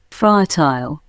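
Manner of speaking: British accent "Fertile" as FUH-tile, long I; British accent: end "Fertile" with "tile"; British accent demo. Shown as a demonstration. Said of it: "Pronunciation of particular words".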